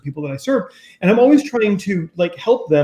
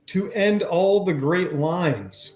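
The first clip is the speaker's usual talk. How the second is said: slow reading; 4 dB quieter, much slower than usual. Retold or imitated. Reading Chelsea's message aloud, slowly. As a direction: read out slowly.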